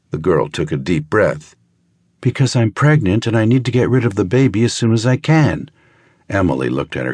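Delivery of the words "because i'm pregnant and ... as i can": put-on young woman, blurting. Girl confessing to the doctor why she came, tense and rushed. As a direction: get it out all at once before you lose your nerve.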